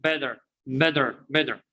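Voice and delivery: better said bedder; American flap T. Shown as a demonstration. Teacher drills class on better as bedder. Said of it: "but in the American".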